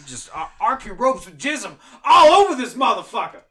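manic laughing shout. Acted.

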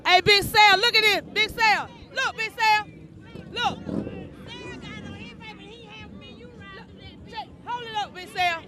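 proud show-off to Big Sal; excited calling. Real, not acted. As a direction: call your friend over, proud and thrilled. Shows off gift to friend.

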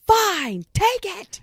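high, strained give-up cry; high mocking cry of defeat; mock defeated yell. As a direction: shriek it like a man who gives up. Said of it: "He goes".

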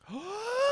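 very high, delighted sharp gasp; excited gasp. Retold or imitated. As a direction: gasp like you can't believe your luck. Reacting to crush's offer.

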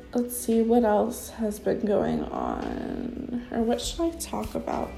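ordinary talk

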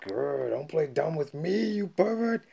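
high, loud mock accusation, rising at the end; hammed-up furious accusation; acted fired-up anger. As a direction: imitate an outraged woman scolding. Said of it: "like, getting all fired up".

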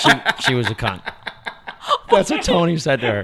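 loud laughing talk. Real, not acted. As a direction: laugh through the words. Laughing while talking.

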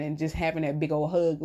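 normal talking voice

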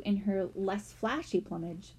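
usual speaking voice

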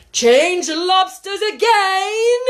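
very high, loud, stretched yell; top-of-voice cry. A narrator's reading. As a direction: shout at the top of your voice. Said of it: "at the top of its voice".

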